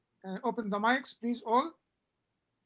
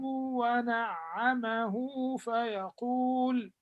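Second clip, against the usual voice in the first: measured melodic Quranic reading; Quran recitation; 4 dB louder than usual. Acted. Teacher recites Quran verse.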